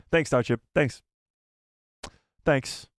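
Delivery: normal talking voice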